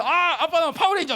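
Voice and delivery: 높고 크게 들뜬 다섯 살 흉내; 신나서 외치는 꼬마처럼. Retold or imitated. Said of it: "난리가 나서 막 돌아다니면서". (아빠에게 자랑하는 아들 흉내, 들뜬 목소리)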